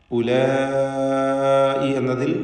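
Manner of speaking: drawn out ഉലായി as in tajweed; elongated vowel. Shown as a demonstration. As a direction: stretch ഉലായി with a long drawn madd. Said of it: "ഉള്ള ദീർഘം".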